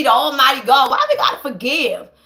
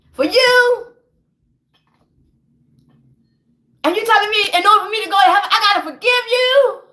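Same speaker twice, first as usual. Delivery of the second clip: raised pitch, wounded outrage; indignant confrontation; 5 semitones higher than usual. Acted. Betrayed believer confronts the one they took for God, indignant.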